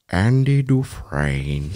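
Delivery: very slow, low, soft actor impression; Morgan Freeman. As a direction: draw the name out low and softly. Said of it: "Morgan Freeman".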